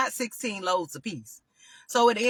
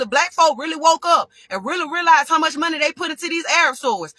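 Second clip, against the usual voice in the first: loud, higher heated rant building up; 6 semitones higher, 6 dB louder than usual. Real, not acted. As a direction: tell them off, voice climbing and loud. Ranting at store owners.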